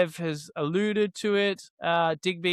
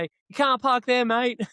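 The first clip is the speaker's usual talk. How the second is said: Aussie yell from a passing car, raised pitch and slower; mock yell out the window; 8 semitones higher, slower than usual. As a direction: call it out the window like a passing larrikin. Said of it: "yell out the window".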